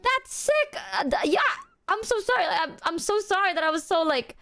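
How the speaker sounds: high, laughing mock apology; giggly apology. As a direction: gush sorry through giggles.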